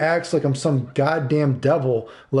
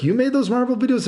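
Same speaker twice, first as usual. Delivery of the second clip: imitating a stunned fan, pitched much higher; hammed-up retold amazement; mock amazed question; 9 semitones higher than usual. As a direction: play up the disbelief on those. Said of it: "He's like".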